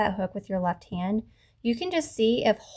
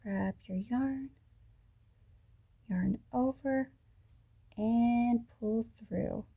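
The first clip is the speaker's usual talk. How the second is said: slow, softer step-by-step instruction; slow demonstration; 4 dB quieter, much slower than usual. Shown as a demonstration. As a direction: draw each step out, with pauses to follow along. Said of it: "So I'll do this slowly".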